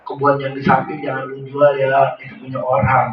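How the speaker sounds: rambling. Retold or imitated. Dying man talking nonsense about the garden. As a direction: wander off like a delirious dying man.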